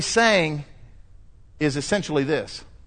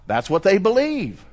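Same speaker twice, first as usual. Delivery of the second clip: usual speaking voice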